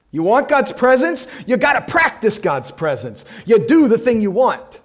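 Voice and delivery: loud, high exhortation; preaching at full force. Acted. Preacher urging congregation, loud and high. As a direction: drive it home.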